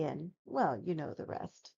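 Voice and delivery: ordinary delivery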